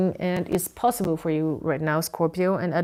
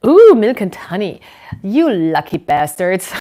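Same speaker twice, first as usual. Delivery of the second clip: much louder, thrilled ooh at the card; playful envious cheer, much louder; delighted exclaim; 10 dB louder than usual. Real, not acted. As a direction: gush over their luck.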